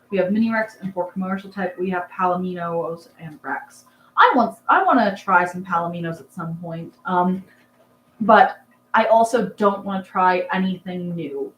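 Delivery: usual speaking voice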